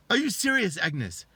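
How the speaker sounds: high, loud incredulous confrontation; outraged husband. Retold or imitated. Husband confronts his cheating wife on the phone, outraged. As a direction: act out a husband catching her out.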